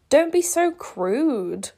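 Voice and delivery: prim scolding. Acted.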